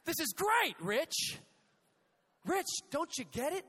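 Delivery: dad shouting with delight from a hole; cheerful dad shouting. Retold or imitated. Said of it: "My dad said".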